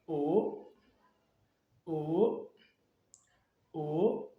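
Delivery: "O" rising at the end; soft upward sweep on each "O"; second tone. Shown as a demonstration. Teacher leads learners through vowel O, "O" rising softly. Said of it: "Le deuxième".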